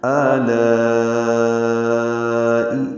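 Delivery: آلائی chanted with the long aa held well past four counts; آلائی with a very slow sung aa; long madd recitation. Shown as a demonstration. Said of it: "ہم اس کو چار حرکات سے زیادہ لمبا کرتے ہیں".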